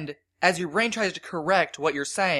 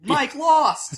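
excited; 9 semitones higher than usual. Real, not acted. Gleeful son announces dad lost.

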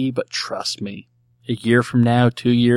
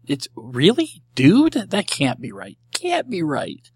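imitating a wife's rising panic; high, disbelieving questions, acted out; mock panic; 6 semitones higher, slower than usual. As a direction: play up flustered disbelief, higher pitched. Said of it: "my wife starts to panic".

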